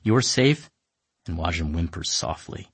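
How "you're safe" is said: high, gentle calming voice; soothing. Acted. Emika calming Wajim down, soothing. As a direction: hush him like a scared animal. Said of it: "Emika goes".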